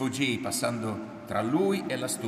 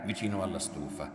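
usual speaking voice

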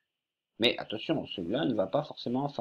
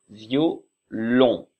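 violon posé lentement; lent, dicté; 6 dB louder than usual. Shown as a demonstration. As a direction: dictez violon calmement. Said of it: "dictée de mots". (violon lent, voix de maîtresse)

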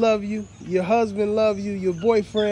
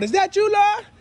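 high, loud shout across the store; screaming out; 13 semitones higher, 4 dB louder than usual. Retold or imitated. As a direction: call it out like spotting a celebrity. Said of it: "screaming out".

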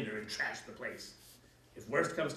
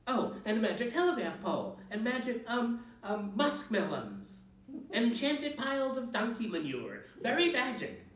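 higher, louder scoffing list; mocking witch; 8 semitones higher, 4 dB louder than usual. A narrator's reading. Witch mocking the fairy tale to Kat, scoffing. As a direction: sneer at each magic thing. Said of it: "hissed the witch".

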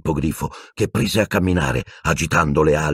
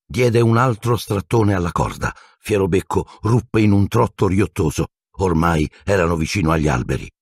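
plain reading voice

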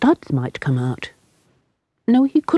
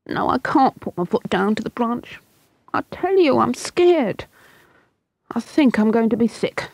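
put-on higher, queasy scared boy; frightened boy; 7 semitones higher than usual. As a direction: play the boy feeling sick with fear.

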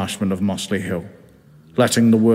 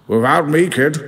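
higher, hearty sea captain voice; grand old skipper welcoming the kid, higher voice; Captain Birdseye; 7 semitones higher than usual. Acted. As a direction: play the cigar-puffing captain, pitched up. Captain speaks again.

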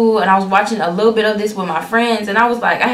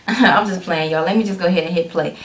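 ordinary talk, nothing marked

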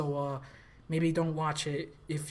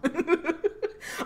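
high burst of laughter; 11 semitones higher than usual. Real, not acted.

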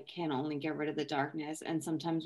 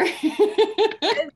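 loud, high burst of giggles; giggling outburst; high laugh; 12 dB louder than usual. Real, not acted. Laughing after handing over.

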